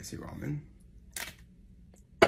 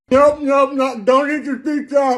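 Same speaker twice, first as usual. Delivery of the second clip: high, frantic and pained; panicked; 11 semitones higher, slower than usual. Real, not acted. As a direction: blurt it out as it burns. Pizza too hot.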